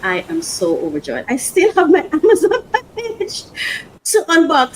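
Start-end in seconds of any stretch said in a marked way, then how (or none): 1.3-4.8 high, laughing delight; laughing with joy. Real, not acted. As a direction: break into real laughter mid-sentence. Overjoyed, laughing about another package.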